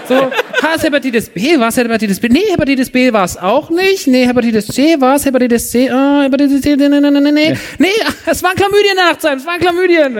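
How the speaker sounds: high, dithering voice stumbling through results; mimicked fumbling receptionist. Reading test results to the patient, high fumbling receptionist voice. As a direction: play her reading a list, lost and hesitant.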